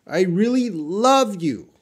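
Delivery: high, emphatic swell on "love"; stress on LOVE. Shown as a demonstration. Teacher reading out the capitalized word, heavy stress on "love". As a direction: lean hard on "love", pitched high. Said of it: "They want to emphasize love".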